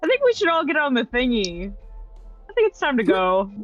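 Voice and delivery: normal talking voice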